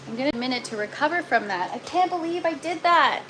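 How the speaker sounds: normal talking voice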